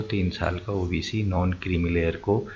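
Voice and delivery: ordinary talk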